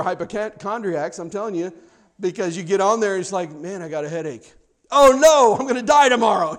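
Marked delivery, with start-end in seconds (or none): 3.5-6.6 rising into a hammed-up louder, higher wail; mock panic. Hypochondriac reading WebMD, mock panic. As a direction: play the hypochondriac sure he's dying.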